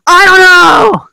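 loud, high, drawn-out mock outburst; high-pitched acted yell, stretched out; exasperated shout. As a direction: shout it back like someone fed up. Acted reply when asked why mad.

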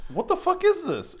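high-pitched incredulous question; baffled disbelief. Retold or imitated. Acts out own baffled reaction.

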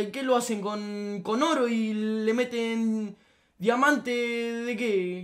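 drawn-out sarcastic question; slow mock. Real, not acted. Asking viewers about the pricey ice cream, slow and sarcastic. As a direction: stretch each word in disbelief.

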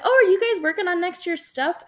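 chirpy high-pitched innocent question; mock breezy question. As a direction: sound casually bright while caught off guard. Mortified question to teammates, retold.

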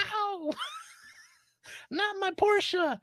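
high-pitched mock wail; drawn-out, hammed-up childish cry; imitating crying kid. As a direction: whine it like a bawling little kid, half laughing. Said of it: "like, little kids crying".

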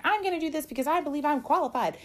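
high, loud mock-defiant quote; loud, high sassy attitude; bossy disrespectful. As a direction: play the mouthy young upstart. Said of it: "you get disrespectful and you get bossy with people and you're like".

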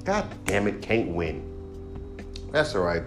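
ordinary talk, nothing marked

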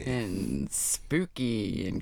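low, very slow cartoon baddie; cartoon villain voice. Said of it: "The cartoon villain voice is funny".

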